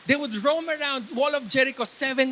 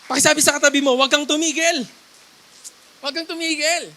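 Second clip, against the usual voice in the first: loud, raised exhorting call; emphatic preaching; 3 semitones higher, 8 dB louder, faster than usual. Real, not acted. Preacher has church tell neighbours not to stop, emphatic preaching. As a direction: call it out for the congregation to repeat.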